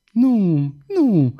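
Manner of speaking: high, horrified denial. A narrator's reading. Girl denying it to the young man, horrified. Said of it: "răspunse ea îngrozită".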